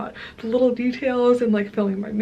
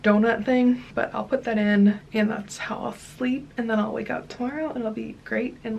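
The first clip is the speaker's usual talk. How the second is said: ordinary talk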